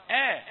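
آ of أعطى said thin and light; light hamza. Shown as a demonstration. Teacher isolating the thin hamza. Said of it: "والعين رقيقة أيضًا".

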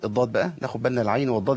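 ordinary delivery

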